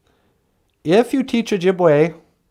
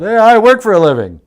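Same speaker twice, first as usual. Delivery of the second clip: louder, higher hammed-up protest; mock indignant retort; 7 semitones higher, 7 dB louder than usual. Son retells answering mother's loan request, mock indignant. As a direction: deliver the comic protest at full voice.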